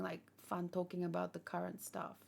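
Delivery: usual speaking voice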